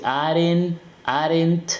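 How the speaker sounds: "aren't" in two syllables, "AH-rent"; mispronunciation. Shown as a demonstration. Teacher imitating what people say, wrongly two-syllable "aren't". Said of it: "Este es muy mal pronunciado".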